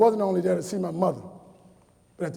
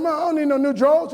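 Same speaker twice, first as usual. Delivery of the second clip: protesting son; 6 semitones higher, 3 dB louder than usual. Retold or imitated.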